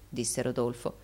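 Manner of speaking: plain reading voice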